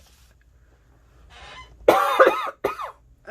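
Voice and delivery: loud coughing fit; harsh cough. Real, not acted. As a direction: hack out a cough. Coughing fit while crafting.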